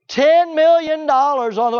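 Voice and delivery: high, loud disbelief at the sum; incredulous exclamation. Real, not acted. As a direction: cry out the figure as outrageous. Incredulous at the wedding cost.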